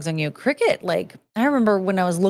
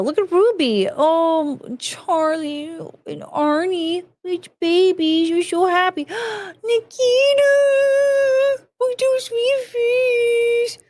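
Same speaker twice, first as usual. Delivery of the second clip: much higher squeaky puppy talk; 10 semitones higher than usual. Real, not acted. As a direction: talk to the dogs like babies, way up high. Baby-talking to dog photos.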